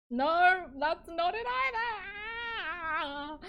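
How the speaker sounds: very loud, high, drawn-out wail; exasperated wail. Real, not acted. Streamer wailing at yet another failed take. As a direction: stretch it into a loud despairing cry.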